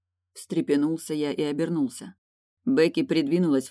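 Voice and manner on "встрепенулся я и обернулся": usual speaking voice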